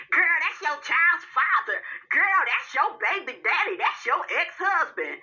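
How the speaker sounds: high-pitched mocking imitation of social media women; mocking silly women. Women on social media excusing an ex, high mocking imitation. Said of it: "so many stupid women on social media".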